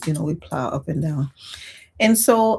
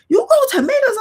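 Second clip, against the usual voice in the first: high, loud, shocked disbelief with a laugh; shocked neighbor; 7 dB louder than usual. Retold or imitated. Imitating the neighbor stunned that she grows tomatoes, high and loud. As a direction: mimic her amazed disbelief. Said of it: "And she was shocked".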